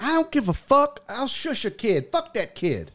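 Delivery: defiant. Retold or imitated. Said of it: "I was like".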